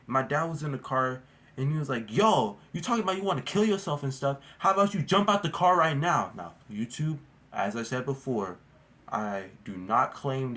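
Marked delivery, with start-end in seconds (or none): 2.1-6.3 imitating a raised, angry father; a mild mock yell of the furious dad. Dad in the car snapping at his son, mock angry.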